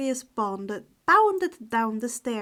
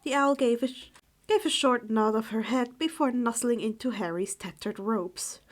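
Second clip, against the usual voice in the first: usual speaking voice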